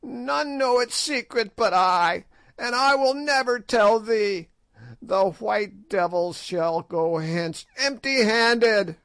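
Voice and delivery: high, taunting crone voice, slowing at the end; a spiteful hag refusing, pitched high; old sorceress gloating over her secret; defiant old witch. A narrator's reading. Gagool defies the king.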